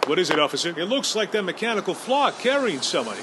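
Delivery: ordinary delivery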